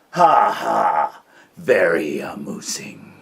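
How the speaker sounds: loud, slow mocking bear voice; booming sarcastic bear; sarcastic Bear roar. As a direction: sneer the laugh like a grumpy bear. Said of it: "roared Bear".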